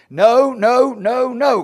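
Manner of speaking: emphatic denial. Real, not acted. Preacher denying Christ would condemn us, emphatically.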